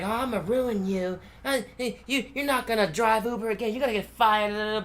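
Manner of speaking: mildly hammed-up threatening; mock belligerent threat. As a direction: mimic a belligerent rider. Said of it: "being belligerent".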